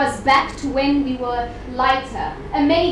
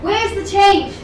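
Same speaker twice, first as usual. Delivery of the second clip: loud, raised challenge; demanding call; 5 semitones higher, 8 dB louder than usual. Acted. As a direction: throw the question out to the whole hall. Poem voices people's demand.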